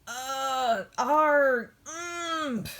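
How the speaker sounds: high effortful grunts and groans; straining grunts. Acted. Backhoe yanking the stump, straining grunts.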